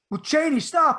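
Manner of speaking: high, loud snapping at Chaney; exasperated shout. Retold or imitated. Yelling at Chaney.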